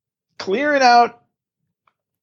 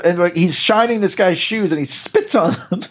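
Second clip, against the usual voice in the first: usual speaking voice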